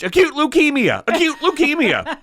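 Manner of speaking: high, loud triumphant shout; mother screaming. Retold or imitated. Mother shouting the diagnosis in the lecture hall, loud. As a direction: blurt the answer out like a proud mother. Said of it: "she screams out".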